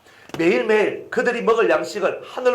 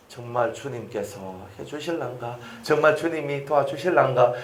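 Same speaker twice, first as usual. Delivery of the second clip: acted doubt; 4 semitones lower, 3 dB quieter than usual. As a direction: voice a wavering believer, starting soft and low. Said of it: "부정적인 마음을 가지게 되는".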